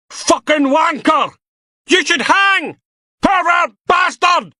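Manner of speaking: high, slow, furious shouting; an enraged protester yelling at the prisoner; furious insults. Acted. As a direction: scream abuse at him. Crowd members hurling insults.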